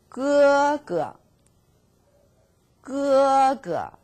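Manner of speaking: 哥哥 said high and level; held first tone. Shown as a demonstration. Teacher models 哥哥, first tone held high. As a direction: keep 哥哥 high and flat. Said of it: "hard to hold".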